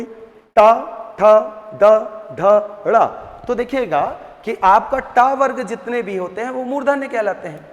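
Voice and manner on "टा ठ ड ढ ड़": टा ठ ड ढ ड़ said crisply, tongue curled up to the roof; टा ठ ड ढ ड़ each spoken alone with the tongue tip up; retroflex letters demo. Shown as a demonstration. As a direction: tap out टा ठ ड ढ ड़ one by one, tongue raised. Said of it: "आप टा बोलोगे तो जीभ पहले ऊपर खड़ी होगी".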